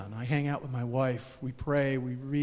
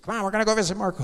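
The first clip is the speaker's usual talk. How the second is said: lightly voiced eager old lady; 4 semitones higher, faster than usual. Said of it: "She said".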